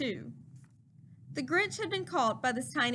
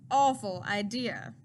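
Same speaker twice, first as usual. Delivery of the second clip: sinister emphasis; 4 semitones lower than usual. A narrator's reading. Slower ominous retelling.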